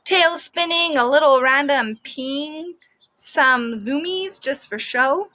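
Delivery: ordinary delivery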